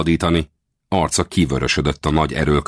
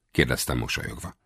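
ordinary delivery, nothing marked

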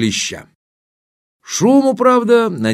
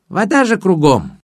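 apologetic; 9 semitones higher than usual. Acted. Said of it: "оправдался водяник".

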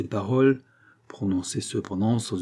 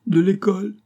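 high, louder incredulous question; acted shock, pitched up; 10 semitones higher, 5 dB louder than usual. A narrator's reading. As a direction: echo it in scandalised disbelief. Aunt's shocked question to nephew.

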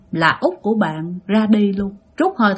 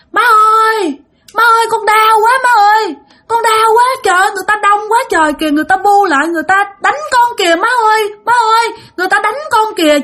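pained wailing; 10 semitones higher, 4 dB louder, faster than usual. Retold or imitated. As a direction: wail in pain, pleading for help. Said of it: "út của bạn gào lên, la làng lên".

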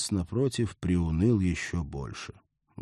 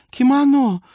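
high, loud gushing word; excited; 11 dB louder than usual. Acted. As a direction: savor кимоно high and loud. Dreamy gush.

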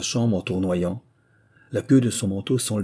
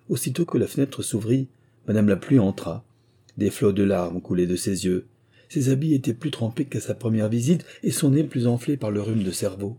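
plain reading voice

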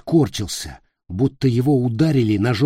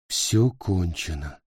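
lifeless despair; 4 semitones lower, 4 dB quieter, slower than usual. Acted. Despairing Peter.

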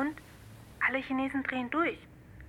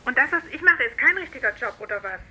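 louder, indignant retort; offended outburst; 8 dB louder than usual. Acted. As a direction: bristle back at the dismissal, loud. Sister offended in phone quarrel.

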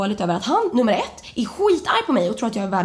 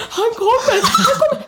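high, loud panicky cry; retold panic, crying out for mum; panicked warning; 12 semitones higher, 5 dB louder than usual. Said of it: "Förstår ni hur rädd jag är".

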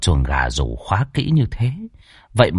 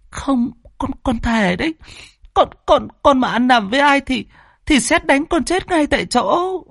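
put-on much higher, tearful teenage girl pleading with her father; whimpering schoolgirl. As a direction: plead like a frightened young girl, voice raised high. Daughter swears innocence.

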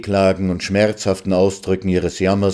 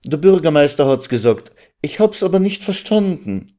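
mildly urgent, higher-pitched questioning; sudden calling out; 6 semitones higher than usual. Acted. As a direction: break in abruptly, pressing for an answer. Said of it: "Mit einem Male rief sie". (plötzlich fragend ausrufen)

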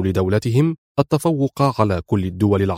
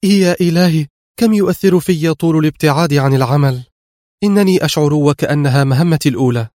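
higher-pitched, emotional exclamation; agitated murmur; 5 semitones higher, 3 dB louder than usual. A narrator's reading. Agent clinging to her partner on arrival, agitated and high-pitched. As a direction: let nerves lift the pitch all through. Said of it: "وهي تغمغم في انفعال".

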